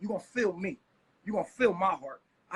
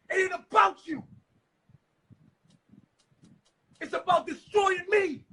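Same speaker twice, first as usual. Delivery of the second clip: angry shouting; 5 semitones higher, 5 dB louder than usual. Real, not acted.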